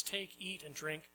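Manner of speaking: soft, hushed offering to take, eat and drink; gentle invitation. Acted. Preacher voicing Wisdom softly inviting the simple to eat. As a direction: invite them in softly, like communion.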